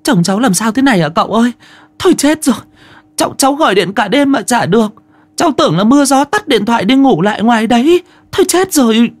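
very high, fast, frantic wailing voice; panicked wife. Acted. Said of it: "miệng hốt hoảng".

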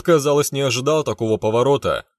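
usual speaking voice

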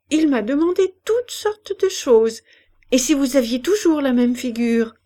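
a lightly voiced old woman's eager cry. Old woman announcing the doctor came, mild eager cry. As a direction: call it out, pleased. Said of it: "elle cria".

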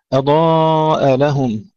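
أضاء لهم drawn out with a firm hamza break. Shown as a demonstration. Teacher chanting the verse to the class. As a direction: recite أضاء لهم slowly, separating the words. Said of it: "هنا ننتبه أن نفصل الكلمتين".

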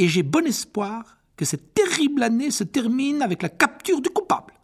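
annonce triomphale, voix aiguë; roaring rallying speech. Acted. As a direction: rallier la salle avec espoir. Discours d'annonce de McGonagall. (discours enthousiaste)

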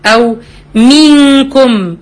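منكم said very slowly with a held nasal noon; recited ghunna. Shown as a demonstration. Teacher reciting a Quran example slowly. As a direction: hold the noon in منكم as a long hum. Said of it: "أنا بقول منكم".

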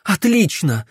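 high, curt exclamation; tossed-out retort. A narrator's reading. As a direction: throw the word out in anger. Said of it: "бросила Генриетта". (бросить резко, с вызовом)

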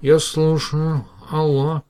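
slow, drowsy voice just woken by the phone; sleepy. Acted. Professor woken at night answers the phone, slow and sleepy. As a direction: drag the words out half asleep. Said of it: "сонный голос произнёс".

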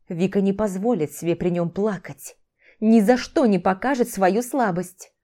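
ordinary delivery

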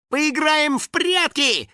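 high, drawn-out taunting call; shouting. A narrator's reading. House spirit vanishing into the corn, shouting. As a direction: call it out high, stretching the words. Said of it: "крикнул он".

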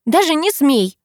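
high, nervy prohibition; nervous warning. A narrator's reading. Girl forbidding the driver to touch her, nervous. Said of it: "нервно бросает Люси".

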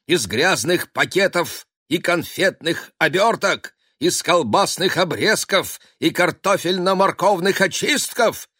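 high, loud, grandiose incantation; pompous wizard cat. Acted. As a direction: build to a triumphant wizard cry. Cat casting a grand spell.

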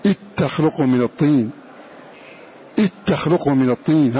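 إذ تخلق said "ittakhluq", the ذ swallowed by the ت; dh merged into t. Shown as a demonstration. Teacher showing students how it sounds: إذ تخلق as "ittakhluq". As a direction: push إذ into تخلق with a doubled t. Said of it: "هكذا".

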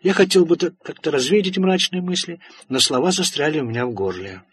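usual speaking voice

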